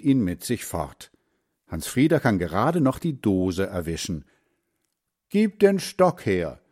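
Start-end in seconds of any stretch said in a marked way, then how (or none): 5.3-6.6 put-on higher, firm old man's demand; firm grandfather. A narrator's reading. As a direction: demand the rod, no arguing. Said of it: "sagt Großvater".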